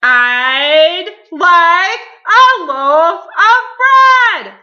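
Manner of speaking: huge, high, very drawn-out bellow; roar. A narrator's reading. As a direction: roar each word out slowly. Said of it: "roared Mister Noisy".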